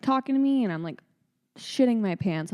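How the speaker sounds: usual speaking voice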